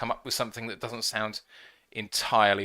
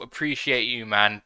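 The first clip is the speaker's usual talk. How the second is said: ordinary delivery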